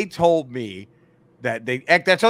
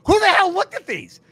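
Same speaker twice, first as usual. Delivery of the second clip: high, loud disbelief; indignant doctor; 8 dB louder than usual. Retold or imitated. Doctor seeing another clinic's X-ray diagnosis, loudly indignant. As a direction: burst out in affronted disbelief.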